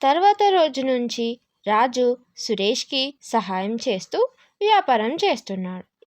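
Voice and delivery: ordinary delivery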